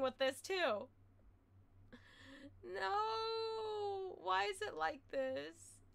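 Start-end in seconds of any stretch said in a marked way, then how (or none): 2.6-5.8 mock despair wail.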